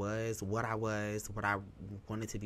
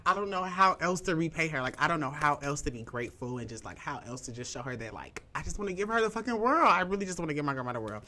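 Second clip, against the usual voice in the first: ordinary talk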